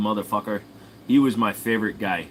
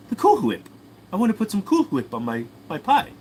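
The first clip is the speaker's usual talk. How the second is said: Stewie; 11 semitones higher than usual. Acted. Baby explains to Brian about pie, high cartoon voice. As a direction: insist way up high, breathy H in "Whip". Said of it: "Can I do Stewie from Family Guy?".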